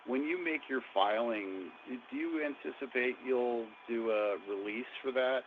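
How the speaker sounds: usual speaking voice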